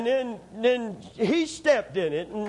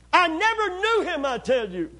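much higher and louder, protesting; insist, voice raised; loud denial; 10 semitones higher, 5 dB louder than usual. Acted. Peter denies again, loudly.

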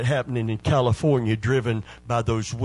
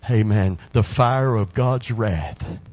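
ordinary talk

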